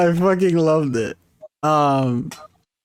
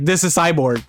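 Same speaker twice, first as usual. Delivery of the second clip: mock demand; 2 semitones higher, 6 dB louder than usual. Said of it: "the Snyder Cut is just like".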